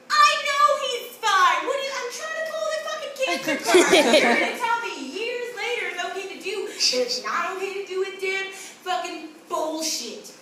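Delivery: high, loud comic protest; indignant outburst. Acted. Child furious at mother spoiling cancer card, indignant outburst. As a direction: explode at being caught out.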